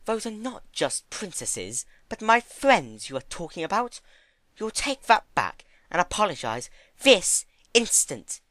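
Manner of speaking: freeze him with each word; icy anger. Acted. Fluttershy scolding Discord for insulting her friends, icy. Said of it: "Frost coated her words".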